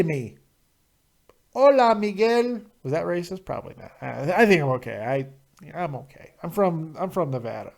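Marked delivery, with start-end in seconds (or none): 1.5-2.6 mock Spanish greeting, higher; a jokey hola to a viewer; exaggerated hola; playful Spanish greeting.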